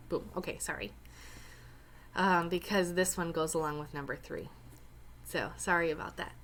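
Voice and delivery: ordinary talk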